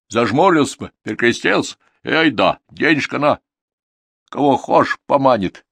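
high, loud folksy voice; picture the leap with relish; lively peasant reasoning; earthy villager, wistfully animated. Acted.

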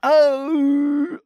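loud, very high howl; wail of pain. A narrator's reading. Worker howls as hammer hits little toe, pained wail. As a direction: shriek from a sudden blow. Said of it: "завопил Тихон".

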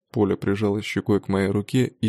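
ordinary delivery

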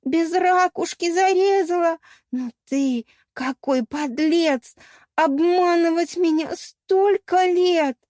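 high, slow, wailing reproach; melodramatic lament. A narrator's reading. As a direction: wail as the betrayed victim. Mermaid's melodramatic lament at betrayal. (надрывное театральное причитание)

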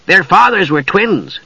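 high, slow eager kender voice; kender explaining. A narrator's reading. Tass explaining the cousins' fathers, high kender voice. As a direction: pipe up with a helpful explanation.

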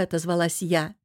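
ordinary delivery

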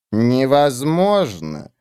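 slow, calm Невозможно; slow agreement. Acted. Witch calmly agreeing with the creatures, slow. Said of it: "согласилась Олар".

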